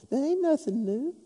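soft, high-pitched playful demo; little high voice. Shown as a demonstration. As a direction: pitch it way up but keep it small. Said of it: "with a little high voice and go".

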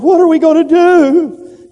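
high, loud panicked cry; panicked servant. Acted. Frightened servant crying out to the prophet, high and loud panic.

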